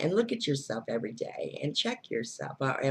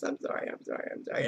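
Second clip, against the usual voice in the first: mock begging; 4 dB quieter, faster than usual. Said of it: "crying and begging the universe".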